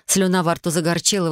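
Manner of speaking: plain reading voice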